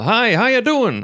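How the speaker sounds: high, loud drawn-out greeting imitating a colleague; glatt tillrop. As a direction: call it out cheerily to a co-worker across the office. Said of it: "ropade han". (glatt utdraget hälsningsrop)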